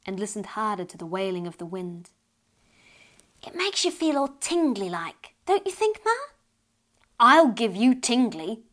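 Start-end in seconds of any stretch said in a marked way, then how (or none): 3.4-6.1 put-on much higher, rising child's voice; a little girl enchanted by the wind; lightly voiced wondering child; dreamy little girl. Child dreamily asks mother. 7.2-8.6 slow, louder mock growl; a mother's threatening growl, half in jest; hammed-up growling mother. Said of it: "growled her mother".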